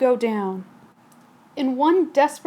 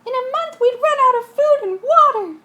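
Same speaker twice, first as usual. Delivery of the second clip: much higher, louder alarmed voice; panicked passenger; 11 semitones higher, 6 dB louder than usual. Acted. Passenger warning the captain about food. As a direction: cry out in alarm.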